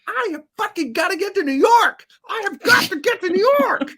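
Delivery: high, loud, desperate yelling; furious outburst. Retold or imitated. Angry first-class passenger yelling about getting to New York, high and loud. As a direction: rant like a stranded passenger losing it. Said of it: "Just, like, really upset".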